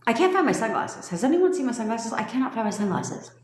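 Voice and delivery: imitating mom. Mocking her mom searching for sunglasses, lightly voiced. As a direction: mimic mom hunting for sunglasses. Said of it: "where she'd be like this".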